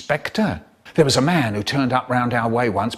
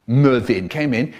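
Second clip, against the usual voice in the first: plain reading voice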